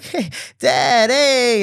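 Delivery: high, loud gushing greeting; exaggerated sing-out hello to dad; overly friendly. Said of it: "trying to be very friendly".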